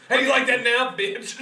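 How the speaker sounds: mock taunt. Acted taunt while cutting a beard.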